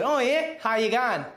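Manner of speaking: imitated Australian accent; casual Australian lilt, put on; Australian counter woman. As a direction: greet like an Aussie woman at the check-in. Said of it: "And she said".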